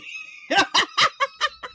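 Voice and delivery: squealing laugh, very high; high shrieking laugh. Real, not acted. Laugh after chimney rant.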